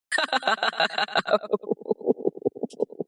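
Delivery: long rhythmic run of laughter; drawn-out stuttering ha-ha laugh; gloating laugh. Acted. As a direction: laugh on and on, gloating over the threat. Gloating after threat against Trump.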